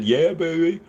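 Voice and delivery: high, drawn-out mock celebration, Australian-free; playful cheer. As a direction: crow it high, rising at the end. Mock cheer at chat's delisting news.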